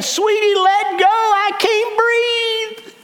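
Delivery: comic strained plea. Acted. Jesus in a comic Dexter voice.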